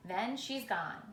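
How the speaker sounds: ordinary delivery, nothing marked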